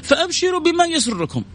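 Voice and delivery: high hopeful voice giving good news; quoting the Prophet. Acted. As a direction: announce glad tidings warmly. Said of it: "قال".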